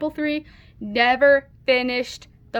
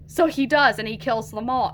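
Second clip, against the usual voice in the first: ordinary delivery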